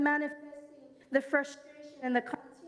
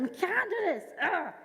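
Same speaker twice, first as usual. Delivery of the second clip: higher, whiny Jamaican patois complaint; mock a frustrated kid giving up; frustrated child; 5 semitones higher than usual. Child at homework, mildly mock frustrated in patois. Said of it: "I would get so frustrated".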